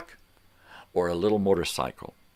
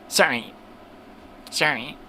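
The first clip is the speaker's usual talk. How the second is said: mock sorries; 8 semitones higher than usual. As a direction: parrot her apology. Mock apology again.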